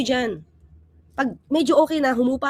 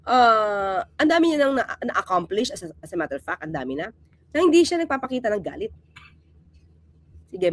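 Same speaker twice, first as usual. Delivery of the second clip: ordinary delivery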